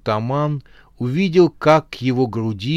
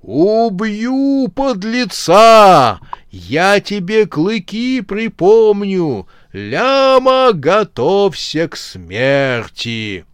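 slow furious roar, much louder; roaring bison; 7 semitones higher, 7 dB louder, much slower than usual. Acted. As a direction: bellow it like a charging beast. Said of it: "он ревел на бегу".

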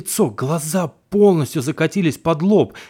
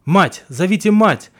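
calling out; 5 semitones higher, 6 dB louder than usual. Acted. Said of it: "слышу бабкин голос".

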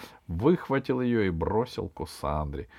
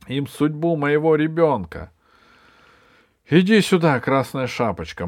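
loud, raised scolding; indignant grandmother; 5 semitones higher, 7 dB louder than usual. Acted. Grandmother summons Красная Шапочка, loud indignant orders. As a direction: call out bossily and demand. Grandmother protests and calls child.

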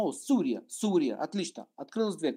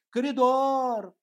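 yelling; 6 semitones higher, 4 dB louder, much slower than usual. Retold or imitated. Man yells again.

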